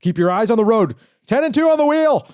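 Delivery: rising into a high, loud order; urgent self-command. Acted. Acts out self-commands to stay focused.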